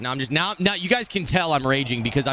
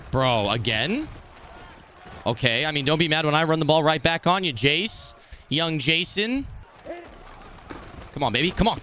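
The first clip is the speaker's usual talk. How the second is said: ordinary talk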